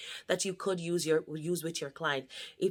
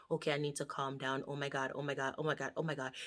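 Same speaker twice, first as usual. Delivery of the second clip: softer, fast anxious mumble; panicked muttering; 2 semitones lower, 9 dB quieter, much faster than usual. Pretend. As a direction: mutter panic under your breath. Client panicking under breath.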